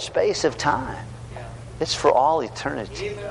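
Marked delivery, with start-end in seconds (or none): none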